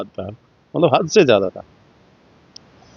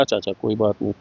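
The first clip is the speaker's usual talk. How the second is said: meek backing down; 4 semitones lower, 8 dB quieter, faster than usual. Retold or imitated. He backs down, scared.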